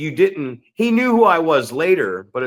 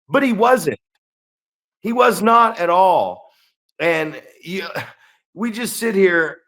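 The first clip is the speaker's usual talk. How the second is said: normal talking voice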